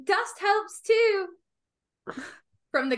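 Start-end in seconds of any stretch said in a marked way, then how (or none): 0.0-1.3 high, raised voice from the kitchen; pitched-up holler to someone in another room; calling out. Acted. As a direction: call across to the next room. Shouting offer from the kitchen.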